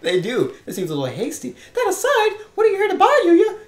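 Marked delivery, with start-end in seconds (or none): 1.7-3.6 put-on much higher, laughing shop girl. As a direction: pitch up, playful. Voicing female shopkeeper.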